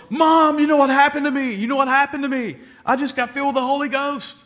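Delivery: high, loud, bursting with joy; excited shout. Retold or imitated. Son bursts downstairs telling his mom, high excited shout. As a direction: blurt it out to Mom, thrilled.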